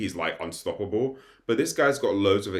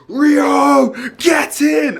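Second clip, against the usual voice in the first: much higher, much louder goal yell; celebrating scream; 13 dB louder, much slower than usual. Retold or imitated. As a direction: scream it like a football hooligan. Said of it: "I screamed".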